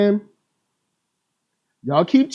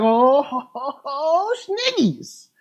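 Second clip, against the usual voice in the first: giggly mock laugh; 6 semitones higher, 5 dB quieter, slower than usual. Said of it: "I'm like".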